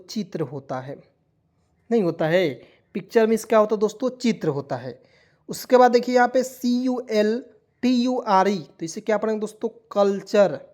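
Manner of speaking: ordinary talk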